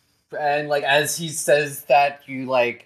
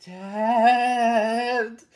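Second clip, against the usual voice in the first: stunned daughter; 9 semitones higher than usual. Acted. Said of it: "she just goes".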